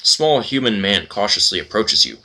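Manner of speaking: usual speaking voice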